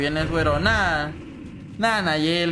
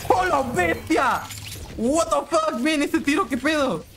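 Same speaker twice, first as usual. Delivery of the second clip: amazed shout; 9 semitones higher, slower than usual. Real, not acted.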